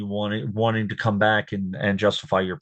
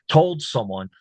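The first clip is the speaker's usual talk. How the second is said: ordinary talk, nothing marked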